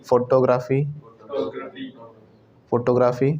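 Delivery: "फोटोग्राफी" as foto-graa-fee, evenly stressed; "फोटोग्राफी" with full FO-TO and long AA; old mispronunciation. Shown as a demonstration. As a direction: say "फोटोग्राफी" like photo plus graafy. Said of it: "पहले सही वाला बोलेंगे".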